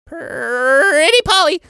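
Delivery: high, slow squawking parrot cry. Acted. Mummy mimicking a parrot from the cupboard, squawking. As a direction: screech it out like a parrot. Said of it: "Is there a parrot in the room?".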